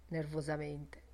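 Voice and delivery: ordinary delivery, nothing marked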